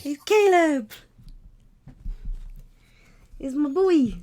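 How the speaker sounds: high, loud delighted outburst; excited cry. Acted. Recognizing a beloved wolf friend, excited high cry. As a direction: cry it out high and full-voiced.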